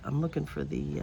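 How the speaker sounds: usual speaking voice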